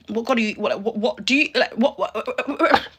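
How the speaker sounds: normal talking voice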